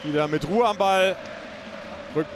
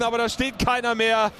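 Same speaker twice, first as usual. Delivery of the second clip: usual speaking voice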